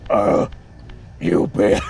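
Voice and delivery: low, flat gravelly mumble of a curse. Retold or imitated. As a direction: growl it low, like a bad Batman. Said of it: "he's just like".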